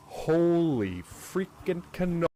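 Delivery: slow, loud astonished cry; excited exclamation. Real, not acted. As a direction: draw it out in shock. Sudden gasp at game event.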